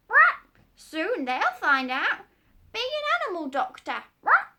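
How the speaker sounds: very high, loud parrot squawk. Acted. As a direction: screech like a bossy parrot.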